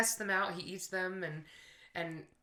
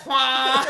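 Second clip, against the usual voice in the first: loud, drawn-out moose call; 5 semitones higher, 15 dB louder than usual. Retold or imitated. Imitating moose sound.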